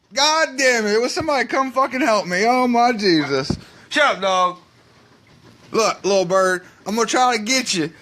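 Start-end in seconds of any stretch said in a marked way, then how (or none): none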